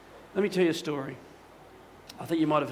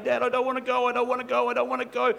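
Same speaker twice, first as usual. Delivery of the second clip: put-on crying little girl; 6 semitones higher, 3 dB louder, faster than usual. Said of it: "the little girl's crying".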